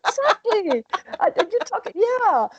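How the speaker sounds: a high laughing "exactly". Real, not acted. Guest laughing agreement with the host. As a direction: laugh the word out in delight.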